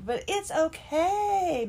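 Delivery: high, slow, singsong "okay"; drawn-out reassurance. Retold or imitated. Amazon agent reassuring a customer about her return, high and drawn out. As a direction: stretch it out high to soothe a customer.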